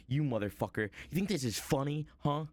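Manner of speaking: mock furious accusation; hammed-up outraged dad; angry man. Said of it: "he's, like, pissed".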